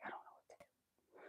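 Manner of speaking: hushed, torn between choices; whispered helpless quote. Retold or imitated. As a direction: whisper it, stuck under a sleeping pet. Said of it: "And so you're like".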